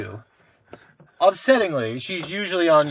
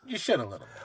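quiet, dry agreement; 12 dB quieter, faster than usual. Real, not acted. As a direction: say it flatly under your breath. Contrast with usual reply.

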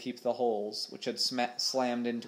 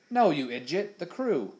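exasperated, higher and louder; irritated snap; 6 semitones higher, 4 dB louder than usual. Acted. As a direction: snap at him like a fool. Captain snaps at Smee.

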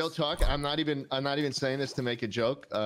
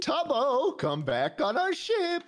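mock menacing call; 13 semitones higher, slower than usual. Threatening Tubbo from ship.

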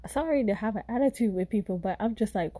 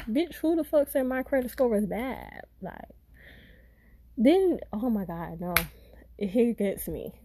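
usual speaking voice

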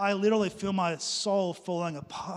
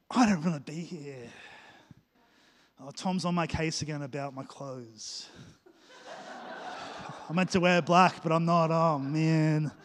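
sulky grumbling; 4 semitones lower than usual. Acted. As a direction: drag it out like a bored reluctant volunteer. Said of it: "and they're going".

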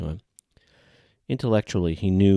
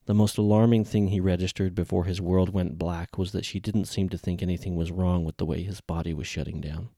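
ordinary delivery, nothing marked

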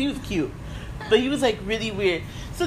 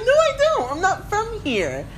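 sharp correction; indignant; 11 semitones higher than usual. Retold or imitated. Correcting the drunk man.

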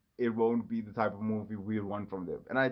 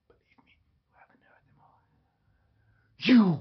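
slow, drawn-out theatrical whisper; dramatic whisper; 8 semitones higher, 11 dB louder, slower than usual. Pretend. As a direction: whisper it slowly like a secret boast. Boast repeated in whisper.

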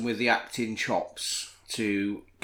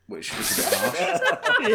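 laughing; 9 semitones higher, 3 dB louder, much slower than usual. Real, not acted. Laughing at daughter's harsh pick.

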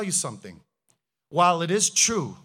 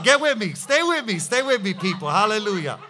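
ordinary talk, nothing marked

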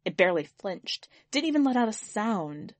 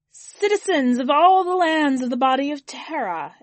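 higher, louder ceremonial alien address; alien orator; 7 semitones higher, 8 dB louder than usual. Acted. Alien benefactor opens speech to jeering mourners, grand address. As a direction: proclaim it to a hostile hall.

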